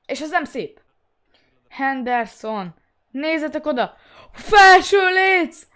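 loud, high drawn-out shout. Real, not acted. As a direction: yell it out slowly at full voice. Hit the crossbar.

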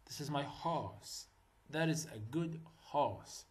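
very slow, soft sentence read for repetition; slow model reading. Shown as a demonstration. Teacher slowly modelling example sentences for students. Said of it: "example sentences read slowly".

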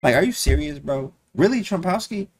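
high-pitched, exasperated disbelief; mock indignation. Streamer complaining about the Trompowsky, mock indignation. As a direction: protest it incredulously, voice climbing high. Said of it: "I'll be so mad".

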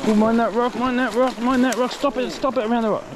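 calling out fast before the fish snags; urgent warning. Real, not acted. Angler urging his mate to keep the fish off the rock, high and urgent.

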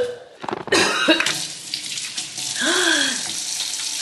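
high breathy gag; gagging gasp. Real, not acted. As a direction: choke back a retch. Gagging at the smell.

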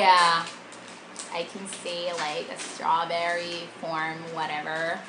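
talking while chewing candy; slightly muffled chewy speech; mouth full, chewing. Real, not acted. Seen 1: Left speaker's mouth works and purses, as if chewing, after eating candy.

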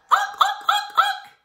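high-pitched repeated parrot squawks. Shown as a demonstration. Teacher showing kids the parrot cheer, high squawking. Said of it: "the chair we're gonna do is parrot".

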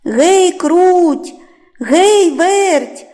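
high, loud rooster's holler; long-stretched hey and names; calling out. A narrator's reading. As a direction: call the mice from across the yard. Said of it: "знов кличе мишенят".

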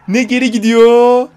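loud slow complaint; loud drawn-out gripe; frustrated yell. Real, not acted. Car stuck in game.

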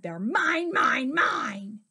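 loud, possessive dinosaur shout; mock-greedy storybook bellow. A narrator's reading. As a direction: shout each word as a claim.

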